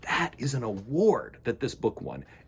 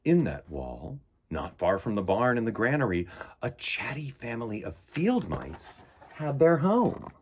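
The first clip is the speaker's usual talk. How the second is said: unhurried picture-book narration; storybook reading. Acted. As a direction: read it like story time for little ones. Reads picture book aloud.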